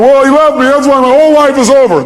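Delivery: high, loud mock lament; upset woman. Seminar speaker mimicking a woman left by her partner, upset. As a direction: imitate a woman wailing over being left. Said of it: "So this woman's going".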